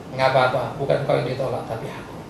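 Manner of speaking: low, soft calming answer; reassuring. Acted. God answers the upset Samuel, low reassuring voice. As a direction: drop low and soothe gently.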